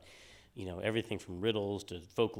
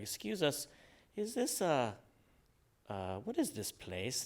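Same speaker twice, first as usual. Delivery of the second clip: mock tourist voice; 6 semitones higher, slower than usual. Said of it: "they're like".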